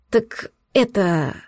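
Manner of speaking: slow, halting and unsure; nervous hesitant start; flustered. A narrator's reading. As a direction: stall nervously on the words. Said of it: "заволновалась я ещё больше прежнего".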